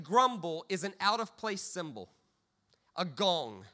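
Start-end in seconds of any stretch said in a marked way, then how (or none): none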